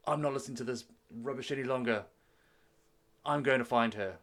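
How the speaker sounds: heated, defiant declaration; storming-out prince. Acted. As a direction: refuse angrily, then declare it loudly. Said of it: "he storms out the room".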